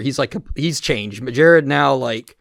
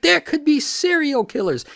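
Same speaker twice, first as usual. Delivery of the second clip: grandma voice. Retold or imitated. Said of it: "She's like".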